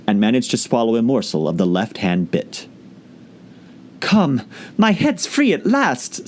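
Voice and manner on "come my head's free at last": put-on high, slow, gleeful little girl; relieved delight, drawn out. Alice finds her head free, delighted. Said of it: "said Alice in a tone of delight".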